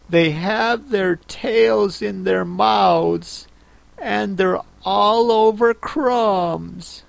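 put-on high, slow, thoughtful girl voice; Alice.

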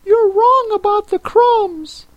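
very high creature voice; Mock Turtle. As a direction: squeak it out in a tiny, very high voice.

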